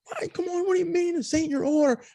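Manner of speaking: high, put-on voice of an irate cashier; high mock outrage from the drive-thru lady; angry cashier. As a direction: play the cashier losing it over nothing. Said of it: "And she's like".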